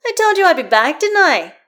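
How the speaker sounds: loud, high-pitched triumphant yell. A narrator's reading. Karlach announcing her return to Astarion, yelling. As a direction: shout it across the rink. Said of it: "she yells".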